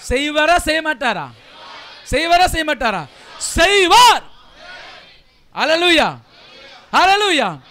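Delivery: loud preacher's call to the crowd; booming, exultant proclamation; rallying shout. Real, not acted. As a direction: fire up the congregation, building to a shout. Rallies congregation with call and answer.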